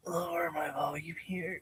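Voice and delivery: soft whisper. Real, not acted. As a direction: whisper it under the music. Lowers volume for the stream.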